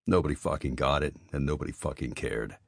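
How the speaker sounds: ordinary delivery, nothing marked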